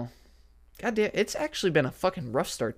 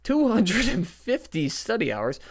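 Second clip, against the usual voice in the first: high, slow sarcastic repeat; 9 semitones higher, 5 dB louder than usual. Retold or imitated. Scoffing at the exam's study hours, high and sarcastic. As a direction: mock-cheerfully restate the absurd number. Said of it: "So I was like".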